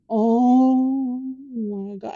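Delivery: very slow, drawn-out retold dismay; stunned dismay. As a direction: stretch every word as it sinks in. Caught out as Dad silently laughs.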